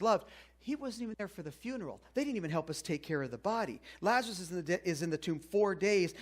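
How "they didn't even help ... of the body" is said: normal talking voice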